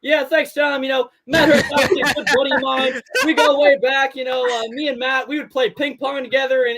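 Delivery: Brad Weiss impression. Imitating Brad answering the host about Matt Hurt. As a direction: answer the host like a friendly athlete. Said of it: "I think you nailed it, Brad".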